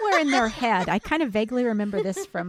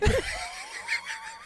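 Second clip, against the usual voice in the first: laughing; 6 dB quieter than usual. Real, not acted. Big laugh at last year.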